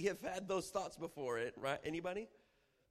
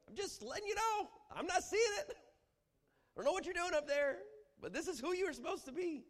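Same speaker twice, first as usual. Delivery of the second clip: sassy scolding; 6 dB louder than usual. Acted. Preacher mocking a believer scolding God. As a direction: tell God off. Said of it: "trying to remind God".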